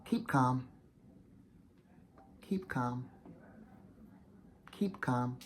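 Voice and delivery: soft, repeated self-calming mantra; a hushed inner reminder to stay cool. Retold or imitated. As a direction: talk yourself down quietly. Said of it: "my intuition says".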